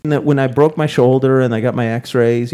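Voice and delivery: ordinary talk, nothing marked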